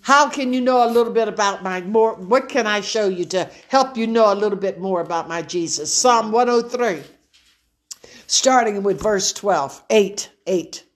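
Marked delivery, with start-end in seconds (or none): 0.0-5.9 rushed. Real, not acted. Excited preacher runs on.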